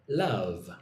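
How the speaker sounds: Love with the tongue tip on the top of the mouth; L sound in a word. Shown as a demonstration. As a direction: start Love with a clear tongue-up L. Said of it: "on the top of your mouth".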